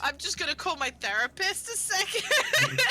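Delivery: loud, rising mock distress; overwhelmed half-joking wail. Real, not acted. Player overwhelmed by emotional scene.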